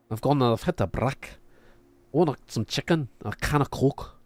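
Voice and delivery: put-on lightly done Geordie accent, brick as brack; Geordie impression. Streamer imitating Stephen Graham's clip, playful Geordie accent. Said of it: "But that's how he says".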